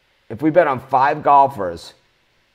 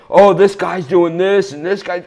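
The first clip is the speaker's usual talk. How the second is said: mocking quote; 7 semitones higher, 5 dB louder than usual. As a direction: parody the accuser. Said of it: "in the Facebook group saying".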